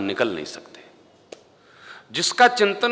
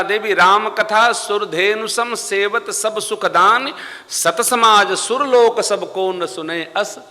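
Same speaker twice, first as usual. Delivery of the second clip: high, slow verse recitation; measured devotional chanting; scripture recitation; 7 semitones higher, 4 dB louder than usual. Real, not acted. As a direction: recite the verse slowly on a raised pitch.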